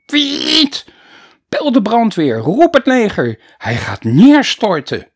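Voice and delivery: high, loud panicked call for help; alarmed shouting. Acted. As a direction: yell for the fire brigade in panic. Gym teacher raising alarm. (paniekerig roepen)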